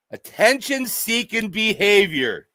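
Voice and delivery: ordinary talk, nothing marked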